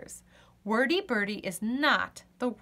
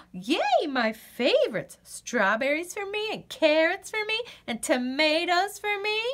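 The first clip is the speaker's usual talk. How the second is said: thrilled squeal; 6 semitones higher than usual. Acted. Birdie finds a garden.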